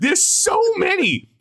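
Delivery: very high-pitched incredulous outburst; exasperated. Real, not acted. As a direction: let the pitch leap up in disbelief. Exasperated rant about task force size.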